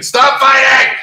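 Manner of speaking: high, loud parent yell; retold yell. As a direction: shout it like a fed-up parent. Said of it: "It's like".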